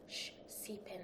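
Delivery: put-on whispered toddler hush. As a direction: breathe it like a toddler copying mum. Baby imitating the hush.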